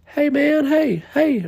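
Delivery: high, drawn-out calling voice; imitating a man hollering across a lot; calling out crackhead. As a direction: call after him like a street guy. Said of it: "the old crackhead dude goes".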